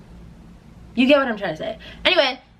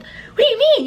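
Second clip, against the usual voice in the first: mom confused; 3 dB louder than usual. Retold or imitated. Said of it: "And she's like".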